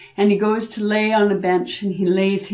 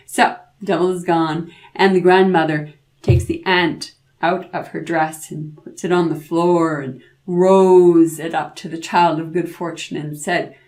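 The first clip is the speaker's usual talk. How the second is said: plain reading voice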